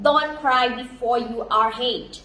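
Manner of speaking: very slow, word by word; slow careful English; slow English proverb. Shown as a demonstration. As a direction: read the proverb slowly for learners. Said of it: "พี่ออกเสียงผิด".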